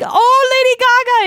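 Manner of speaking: put-on high, gushing British accent shout-out. Host imitating the winner shouting out [name], British accent. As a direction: imitate a British actress calling out, pitched high. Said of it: "in her British accent".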